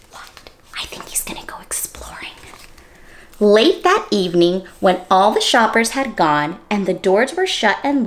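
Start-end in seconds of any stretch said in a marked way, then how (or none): 0.1-2.3 hushed secret whisper. Real, not acted. Teacher guessing the bear's plan, whispered.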